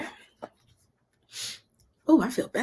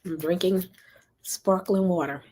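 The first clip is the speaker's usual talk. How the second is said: normal talking voice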